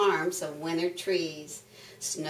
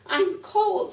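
very high duck complaint; squawking duck; 11 semitones higher, 4 dB louder than usual. Acted. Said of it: "squawked the duck".

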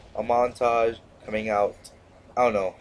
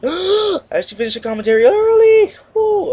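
excited gasp; 9 dB louder than usual. Real, not acted. Gamer cheering at finishing the commentary early. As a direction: gasp then squealed high, loud cheer.

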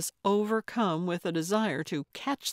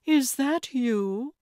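high, loud, drawn-out question; slow dawning joy at seeing an old friend; delighted recognition; 7 semitones higher, 5 dB louder, slower than usual. A narrator's reading. Old friend recognising visitor at door.

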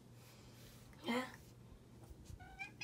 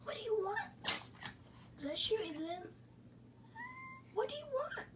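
much higher sing-song pet voice; 10 semitones higher, 7 dB quieter, faster than usual. Real, not acted. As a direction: coo at the dog. Baby-talk to pet.

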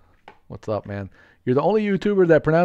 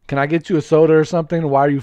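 ordinary talk, nothing marked

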